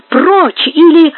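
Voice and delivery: high, loud, slow warning; threatening cry. A narrator's reading. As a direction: force out a drawn-out threat. Woman threatens intruder in narrated quarrel.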